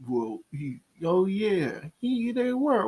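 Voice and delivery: halting start rising high, lightly acted; imitated dawning realisation. Retelling a friend realising Peach was in front, halting then high. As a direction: play a friend slowly catching on.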